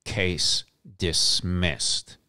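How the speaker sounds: each word drawn out, savoring the win; slow victorious declaration; slow triumphant. Real, not acted. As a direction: let it land slow like a verdict. Triumphant punchline repeated.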